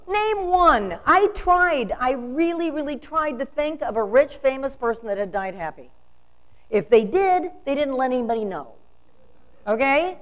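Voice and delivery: loud, high, ringing declarations; full-force preaching. Acted. Minister to congregation, defiant and loud. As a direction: preach it to the back row.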